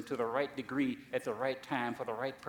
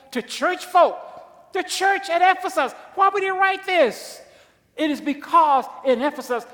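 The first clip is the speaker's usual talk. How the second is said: full-force preaching; 14 semitones higher, 13 dB louder than usual. Acted. Preacher explaining Ephesus, loud and high. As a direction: shout it out to the church.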